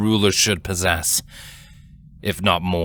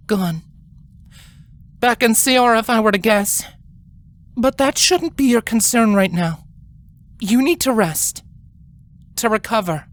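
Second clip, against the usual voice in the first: a higher, measured voice of a grave royal sister; grave elder queen; 13 semitones higher than usual. A narrator's reading. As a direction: speak as the older sister calming her gently but firmly. Celestia speaks to injured sister.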